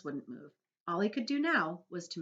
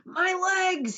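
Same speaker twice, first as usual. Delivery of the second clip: high, loud anguished cry; cry of alarm; 13 semitones higher, 9 dB louder than usual. A narrator's reading. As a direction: cry out in shock at his legs. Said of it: "he cried".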